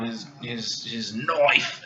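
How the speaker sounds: slow, put-on Australian vowels; Australian accent. Mace Windu pulling his knife, mock Australian accent. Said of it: "he's Australian".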